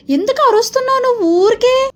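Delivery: high, sharp scolding mother; angry scolding. Acted. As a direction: tell the kid off sharply. Mother scolding shouting daughter.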